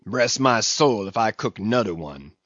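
a touch of angry growl. A narrator's reading. Old cook refusing as he leaves, growled. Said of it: "he growled".

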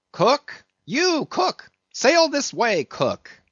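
high hail across the deck; a mate yelling for his cook; calling out. Acted. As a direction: summon the cook from afar. Stubb hailing the cook.

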